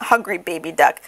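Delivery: plain reading voice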